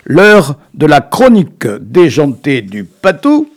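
loud, drawn-out announcer voice for the show opening; hammed-up radio intro. Host opening his daft chronicle on local radio, loud slowed announcer delivery. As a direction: sell the show like a cheesy radio jingle.

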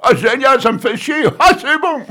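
loud, high mock-gleeful outburst; hammed-up laughing reading. Shown as a demonstration. Showing a fellow reader how to laugh while reading, hammed-up loud hilarity. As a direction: crack up and shout it out, way over the top. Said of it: "tu peux pas rigoler en, en lisant ça".

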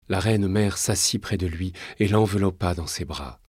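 usual speaking voice

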